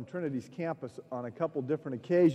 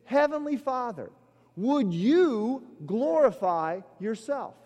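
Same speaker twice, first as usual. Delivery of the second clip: prayerful petition; 9 semitones higher, 5 dB louder than usual. Shown as a demonstration. Preacher voicing the true petition of the prayer, earnest plea. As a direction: ask it humbly then swell. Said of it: "What we are actually praying is".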